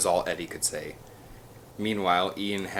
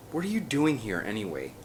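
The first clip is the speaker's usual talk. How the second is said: ordinary delivery, nothing marked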